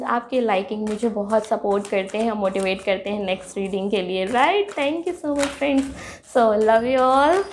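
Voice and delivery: ordinary delivery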